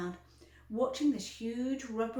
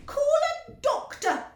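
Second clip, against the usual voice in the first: very high, loud shout; father shouting for a doctor; 10 dB louder than usual. A narrator's reading. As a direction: shout for help. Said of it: "shouted Mister Beauregard".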